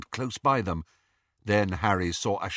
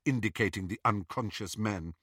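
ordinary delivery

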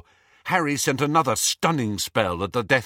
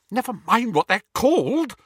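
higher, rushed and tense; a little hysterical; 11 semitones higher, 4 dB louder than usual. A narrator's reading. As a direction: cut him off in rising panic. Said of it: "said Hermione a little hysterically".

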